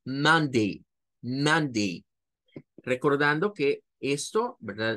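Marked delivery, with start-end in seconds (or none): 0.0-2.0 "Monday" in two clear syllables; two syllables, MAN-day; model pronunciation. Shown as a demonstration. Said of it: "ahí están las dos sílabas". ("Monday" en dos sílabas)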